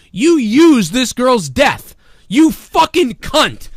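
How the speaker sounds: slow, loud, furious words; slow furious outburst at the actress; angry rant. Real, not acted. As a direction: spit each word slowly in rage. Ranting insults at celebrity.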